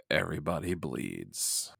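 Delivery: low, hushed poster-tagline delivery; tagline read. Pretend. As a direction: drop it low and soft like a movie tagline. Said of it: "should have been the tagline".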